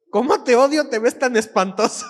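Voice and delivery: high, loud mock-hostile reading; message read as hateful. Shown as a demonstration. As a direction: read the text out as an open insult. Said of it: "tú lees ese mensaje". (lectura ofensiva, fuerte y aguda)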